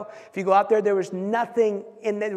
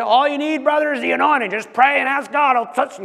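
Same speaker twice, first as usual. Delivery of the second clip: higher, louder mock preacher voice; mock old preacher; 5 semitones higher, 4 dB louder than usual. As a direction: ham up the old-time preacher advice. Mocking old Pentecostal advice.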